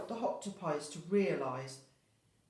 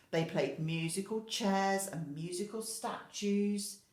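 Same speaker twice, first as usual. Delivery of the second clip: ordinary delivery, nothing marked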